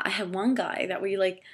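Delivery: normal talking voice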